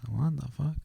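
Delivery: hushed, high rising question; high and very quiet; mock disbelief. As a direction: imitate a wife's soft, incredulous reaction. Wife's reaction to repeated curse.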